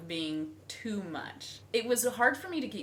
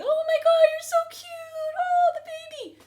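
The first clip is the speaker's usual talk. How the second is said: very high, loud gushing squeal; squealing teenage girls; 11 dB louder than usual. Retold or imitated. Wife imitating teenage girls fawning over her pregnancy. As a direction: squeal like excited teen girls.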